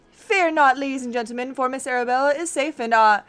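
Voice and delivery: grand, hammy detective reveal; pompous inspector proclaiming to the room; theatrical detective. Acted. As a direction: announce the unmasking with flourish. Game character reveal speech voiced.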